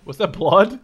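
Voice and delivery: loud, rising disbelief; surprised shout. Real, not acted. Gamer startled by blood on screen, loud and rising. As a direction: blurt it out in shock.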